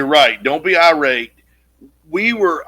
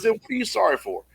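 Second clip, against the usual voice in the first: sugary reassurance; 2 semitones lower, 3 dB quieter, faster than usual. Retold or imitated. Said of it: "laid it on thick as honey".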